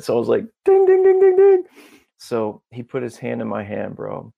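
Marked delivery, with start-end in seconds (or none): 0.6-1.6 high, loud rapid dings; mock bell dinging. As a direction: ring out like a winner's bell. Imitates a bell on agreement.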